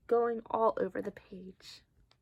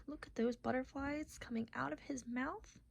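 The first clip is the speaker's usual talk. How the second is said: whisper; 11 dB quieter than usual. Acted. Whispered aside while reading.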